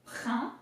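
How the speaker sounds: "prin" with throaty R and nasal vowel; isolated French syllable "prin"; French R emphasis. Shown as a demonstration. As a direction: lean on the R in "prin". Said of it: "emphasis on the R".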